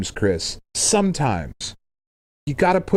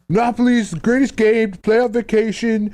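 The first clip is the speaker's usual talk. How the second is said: mock Monopoly fan; 11 semitones higher than usual. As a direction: parody an eager fan gushing about his favourite game. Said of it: "somebody listening to this podcast being like".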